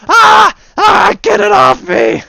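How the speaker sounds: high, loud screams of panic; panicked screaming. Acted. The attacked swordsman screams at the crowd to get Chucky off, loud and high. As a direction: shriek as if clawed at.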